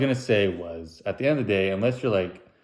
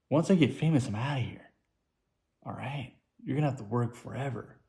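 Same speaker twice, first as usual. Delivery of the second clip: smug gloat; 3 semitones higher, 7 dB quieter than usual. Pretend. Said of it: "And I was like".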